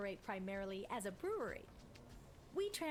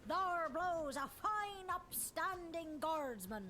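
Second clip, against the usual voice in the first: hearty pirate captain, slow and grand; 7 semitones higher, 3 dB louder, slower than usual. Acted. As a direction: ham it up like an old sea captain. Pirate captain player character.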